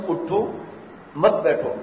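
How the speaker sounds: اٹھو set apart by a clear pause, then مت بیٹھو together; pause after اٹھو. Shown as a demonstration. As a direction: say اٹھو, stop, then run مت بیٹھو as one phrase. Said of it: "بھاگ کون بتائے گا کہ میں نے". (اٹھو کے بعد وقفہ، پھر مت بیٹھو ایک ساتھ)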